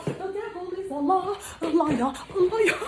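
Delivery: high, laughing the devil is a liar; laughing high exclaim. Retold or imitated. Brushing off her ex, laughing high. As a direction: squeal it through laughter. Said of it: "I'm like".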